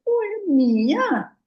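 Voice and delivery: high, slow outraged disbelief; refusing to own the illness, indignant and high; indignant surprise. Retold or imitated. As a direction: reject it as if shocked. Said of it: "Eu digo".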